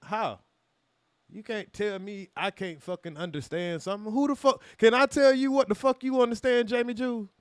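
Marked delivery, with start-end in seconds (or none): 4.1-7.3 heated challenge. Real, not acted.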